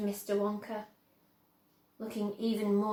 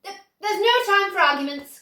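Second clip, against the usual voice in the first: much higher, louder brisk cry; excited cry; 9 semitones higher, 13 dB louder than usual. A narrator's reading. Wonka brushing aside Grandpa Joe's doubts, crying out. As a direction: cut them off eagerly. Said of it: "cried Mister Wonka".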